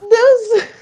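grito agudo com risada; torcida rindo. Retold or imitated. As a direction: anime a amiga entre risos. Colegas torcendo, ela ri. (grito agudo rindo)

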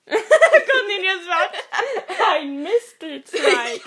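slow, loud laughing words. Real, not acted. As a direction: speak through laughter. Laughing fit.

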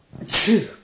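a loud real sneeze; sudden sharp sneeze; sneezing. Real, not acted. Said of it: "Sorry, I'm gonna sneeze here".

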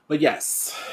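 ordinary delivery

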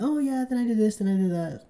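breezy imitated small talk; a put-on woman's voice, chattering lightly; mock chatty woman. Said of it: "And she's chatting".

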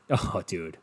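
normal talking voice

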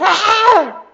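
high, loud terrified cry of a ghost; frightened scream. Retold or imitated. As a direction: shriek it in panic at a ghost. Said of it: "empezaron a gritar, a gritar".

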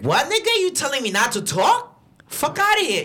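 high-pitched, indignant retold comeback; outraged retort. Driver to passenger who said not to talk, outraged. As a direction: act out an incredulous clapback, pitch jumping up.

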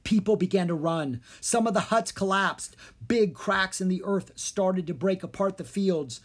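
usual speaking voice

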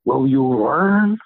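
slow, loud, stern challenge; stern and weighty, stretched out slowly. Retold or imitated. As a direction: imitate a stern old coach, drawing out LEARN. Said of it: "in a very stern voice".

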